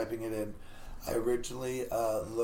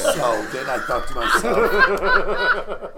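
higher, louder and slow, laughing; 7 semitones higher, 11 dB louder, much slower than usual. Real, not acted. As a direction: stretch it out through laughter. Laughing while telling his pick.

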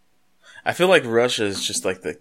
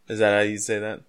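ordinary delivery

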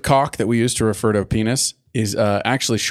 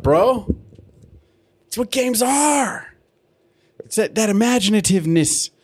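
impassioned rant; 7 semitones higher than usual. Real, not acted. Mock-earnest heated rant on games.

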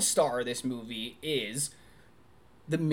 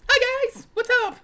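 much higher and much louder, a bubbly squeal; high-pitched Minion voice; 9 dB louder than usual. Retold or imitated. Imitating Minion greeting the guys, high and loud squeal. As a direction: chirp it like an overexcited fish sidekick. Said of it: "he does it in a more high-pitched voice".

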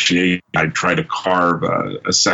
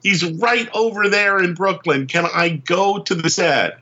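high, pleading mock whine, slowed; begging; 10 semitones higher than usual. Begging his bosses to visit the set, high whiny plea. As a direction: beg like an eager kid. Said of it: "I was begging Marvel".

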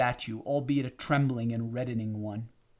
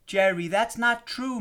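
much higher, insistent protest; conviction; 9 semitones higher, 3 dB louder than usual. A narrator's reading. Marie reassures the upset husband, protesting with conviction. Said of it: "to support the conviction in her voice".

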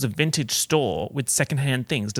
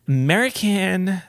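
slow, higher American on American; American accent; 6 semitones higher, 4 dB louder than usual. Shown as a demonstration. Teasing listeners with a mock American accent. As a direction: lean into a mock American twang on American. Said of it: "That's my accent, guys".

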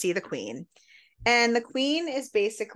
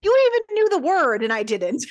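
higher, louder gotcha; pitched-up triumphant jab; 12 semitones higher, 6 dB louder than usual. Retold or imitated. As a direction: crow it like you just won the point. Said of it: "He's like".

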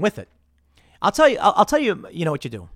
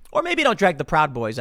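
ordinary delivery

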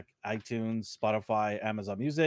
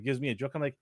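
usual speaking voice

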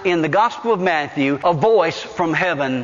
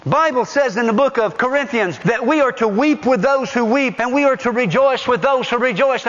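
ordinary talk

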